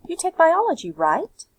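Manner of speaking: "You take biology," high and loud, then a quick rising "right?"; tag question. Shown as a demonstration. Teacher showing students how to ask with a tag. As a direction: toss on the tag "right?" with a rise. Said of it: "by making a tag".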